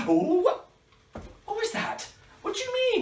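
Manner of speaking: high startled questions; surprised. Pretend. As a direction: react as if startled. Surprise at puppet.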